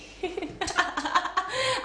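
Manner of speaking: high, drawn-out burst of laughter; amused high giggling peal; real laugh. Real, not acted. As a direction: burst out laughing at your own answer. Laughs after calling men cheesy.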